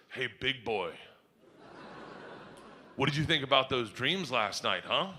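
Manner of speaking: low, soft, confidential tease; sly, knowing. Retold or imitated. Daughter greeting dad at breakfast, sly and knowing. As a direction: sidle up with it, low and quiet.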